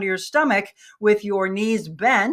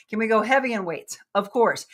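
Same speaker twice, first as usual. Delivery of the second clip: usual speaking voice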